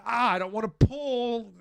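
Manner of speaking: pained cry. Retold or imitated. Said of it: "I was going like".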